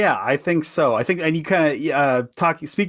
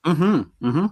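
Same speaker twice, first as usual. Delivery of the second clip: water held in mouth; 2 semitones higher than usual. Real, not acted. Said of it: "I say with my mouth full of water".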